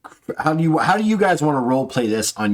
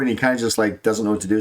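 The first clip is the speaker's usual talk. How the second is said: ordinary delivery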